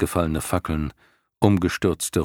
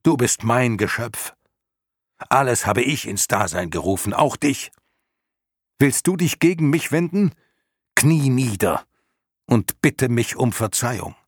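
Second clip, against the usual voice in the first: furious shouting; 5 semitones higher than usual. A narrator's reading. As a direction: rage at the traitor, voice rising. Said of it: "schrie Bastian zurück".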